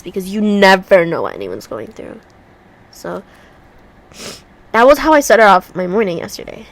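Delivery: normal talking voice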